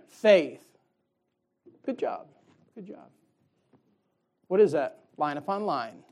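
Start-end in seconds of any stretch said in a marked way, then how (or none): 0.1-0.6 "faith" said again loud and firm, falling at the end; say it again, louder. Shown as a demonstration. Child repeating the word for the Lord, now loud. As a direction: belt out "faith" with confidence this time. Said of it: "Say it again".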